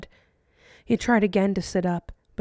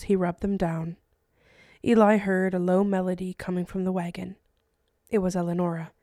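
plain reading voice

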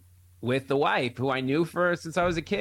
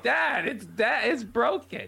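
high, drawn-out protest; mock exasperation, slowing on the complaint; retold exasperated son; 9 semitones higher, slower than usual. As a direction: reason with an impossible dad. Son argues with stubborn dad.

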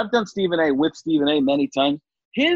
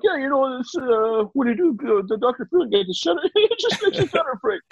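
producer impression; 12 semitones higher than usual. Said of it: "he's like".

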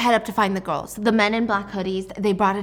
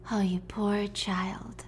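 mock pity; 8 dB quieter, much slower than usual. Villain mocks captive girl.

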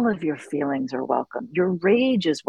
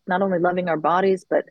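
ordinary talk

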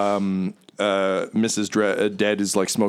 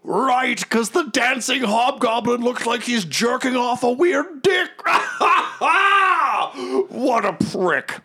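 high booming hero voice with laughter; a braggart guffawing at his own joke; boisterous Magnuson. Acted. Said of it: "he's like".

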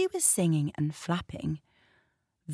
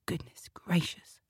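breathy shocked whisper; 7 dB quieter than usual. Real, not acted. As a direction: whisper it aside, scandalised. Narrator aside, whispered.

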